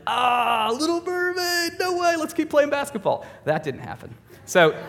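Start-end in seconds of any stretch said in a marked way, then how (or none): 0.1-3.2 teammate. Retold or imitated. Hoped-for teammates shrug it off.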